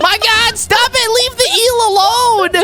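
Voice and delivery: high, loud squeaky cartoon plea; squeaky pleading shout. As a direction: beg loudly in a squeaky voice. Squeaky voice defending the eel.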